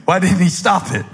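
ordinary talk, nothing marked

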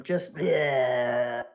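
low, disgusted stomach noise; disgusted bleh. Shown as a demonstration. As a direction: groan out a queasy bleh. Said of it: "That's the sound my stomach makes".

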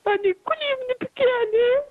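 tearful plea through sobs; speaking while weeping for her son; crying. Real, not acted. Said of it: "هاد البكا اللي راكي تبكي".

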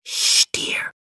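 hushing stage whisper. A narrator's reading. Mother hushes son beside sleeping father, whispering. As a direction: shush a child in a whisper. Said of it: "she whispered".

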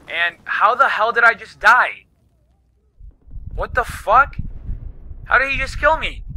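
sudden high disbelief at the death; shocked, can't believe he died; startled outrage. Real, not acted. Gamer just killed, cries out in shocked disbelief.

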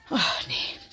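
disappointed whisper. Real, not acted. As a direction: sigh it out quietly. Leise enttäuscht beim Suchen. (enttäuscht geflüstert)

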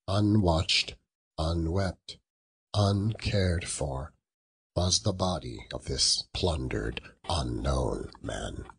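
plain reading voice

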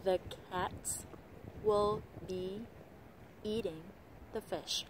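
every word full and separated by pauses; THE, WILL, BE as heavy as CATS; word-by-word reading. Shown as a demonstration. Teacher posing the sentence to learners, slow and word by word. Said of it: "theo kiểu tiếng Việt".

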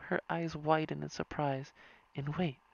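ordinary delivery

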